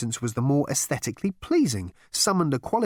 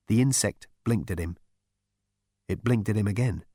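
usual speaking voice

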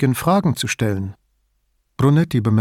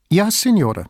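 quick, higher-pitched reply fired off at once; lightly voiced instant answer from a young officer; shot back instantly; 7 semitones higher than usual. A narrator's reading. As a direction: snap back the answer without a beat. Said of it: "wie aus der Pistole geschossen".

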